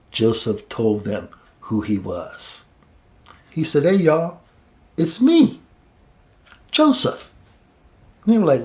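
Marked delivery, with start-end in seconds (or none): 3.8-7.3 rising, lightly voiced reveal; Joseph's reveal. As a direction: announce yourself, climbing higher.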